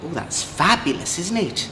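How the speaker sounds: usual speaking voice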